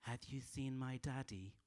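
put-on small, slow child's voice; soft little girl asking sweetly; daughter's voice. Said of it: "and she'd go".

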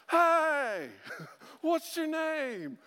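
high, stretched-out holler; mock calling out. Preacher breaking the skit to hail someone, mock holler. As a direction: call out loud, long and high, playfully. Calls out during joking skit.

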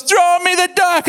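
high-pitched imitated holler; acted shouting. Friend demanding the duck from the far bank, acted shout. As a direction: call urgently across the water. Friend yells across the river.

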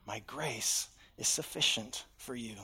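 hushed divine answer; God's soft reply. Acted.

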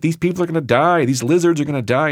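blunt nurse. Retold or imitated. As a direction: play the nurse's dark bluntness. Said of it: "she was like".

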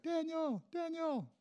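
high, softened calling-out of a name; high-pitched, held-back shout; mock distant shout. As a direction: pretend to holler a name, kept small and high. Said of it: "He shouted your name, right, out loud".

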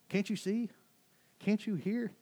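high-pitched exasperated questioning; frustrated Jesus. Acted. Jesus calling out his disciples, frustrated. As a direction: plead in high-pitched disbelief. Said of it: "I like his tone".

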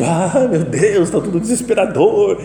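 hammed-up moaning complainer; mock despairing wail. Preacher mocking people who moan about everything, wailing. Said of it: "Muita gente".

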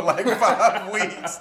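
can barely get it out for laughing. Real, not acted. Co-host cracking up over layaway lamps, laughing loudly.

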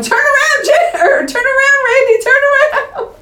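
very high-pitched squealed mock warning; mock frantic yelling. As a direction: squeal it like a panicked viewer warning the hero. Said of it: "should have also been yelling".

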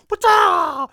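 high loud mock yell; mock shout. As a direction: yell out to distract an intruder. Acting out ambush on intruder.

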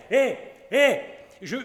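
a flustered man rushing to ask a favour; flustered husband. Acted. Barbouillé accosts the doctor.

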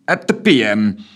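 ordinary delivery

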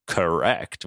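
low, soft deadpan villain; Mister Sinister. Acted. Sinister answers Jean, low and understated. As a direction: drop deep and quiet for the confirmation. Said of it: "And Sinister goes".

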